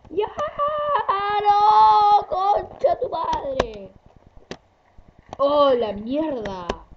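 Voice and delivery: loud slow anguished cursing; furious screaming curse. Real, not acted. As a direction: scream it out in frustration. Character dies, raging.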